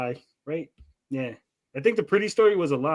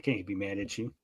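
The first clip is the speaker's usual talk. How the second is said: tender, melted; 7 semitones lower, 11 dB quieter than usual. Retold or imitated. Parent forgiving the child who painted their face, tender and soft. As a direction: let it melt out of you. Parent melting at kid's excuse.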